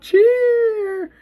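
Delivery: narration soaring into a high cheer; loud, high, drawn-out whoop. A narrator's reading. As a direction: let the last word ring out like a crowd cheering. Narrator acts out the rooftop cheer.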